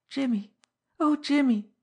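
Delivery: high, anguished plea, falling away; broken sob. A narrator's reading. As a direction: plead as if close to sobbing. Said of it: "in a low, broken sob".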